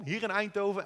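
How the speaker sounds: ordinary talk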